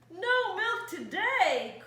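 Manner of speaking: loud slow angry cry; cried out. Acted. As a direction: wail it out in outrage. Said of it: "cried Farmer Brown".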